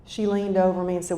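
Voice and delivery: normal talking voice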